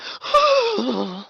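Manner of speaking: high sharp mock gasp; shocked gasp. Miming the tongue's reaction to the water, a mock gasp. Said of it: "your tongue would be like".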